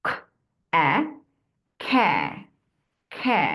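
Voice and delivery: খ then অ্যা blended into খ্যা; blending demo. Shown as a demonstration. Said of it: "দুটো sound মিলে কি হয়?".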